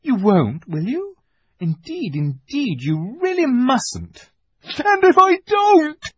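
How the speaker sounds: high, anxious faun voice; pleading faun. A narrator's reading.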